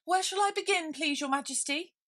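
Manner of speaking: high, flustered rabbit voice. Acted. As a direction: squeak it out in a hurry.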